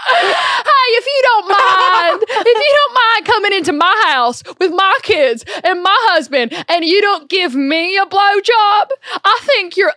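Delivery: a sassy Southern scolding; Southern accent. Pretend. Accent game round, hammed-up Southern scolding. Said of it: "which is Southern".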